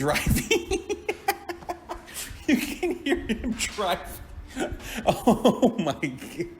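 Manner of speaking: helpless laughter through the words; laughing. Real, not acted. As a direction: crack up, high and drawn out. Cracks up recalling the voice message.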